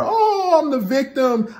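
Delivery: very high, hammed-up whiny victim; mocking the victim. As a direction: overplay the wounded woman. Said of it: "You're yelling at her".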